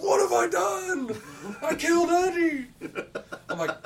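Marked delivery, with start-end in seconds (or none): 0.0-2.7 very high, loud, hammed-up anguish; mock horrified wail. Friend realises he shot his teammate, high mock horrified wail. Imitating friend's horror at killing Eddie.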